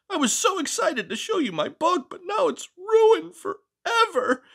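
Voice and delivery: hammed-up high sob over the ruined book; mock whimpering.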